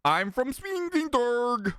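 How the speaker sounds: high, slow put-on foreign voice; mock foreign local. Mock local correcting the streamer, high and slow. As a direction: play a smug local answering proudly. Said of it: "and they're like".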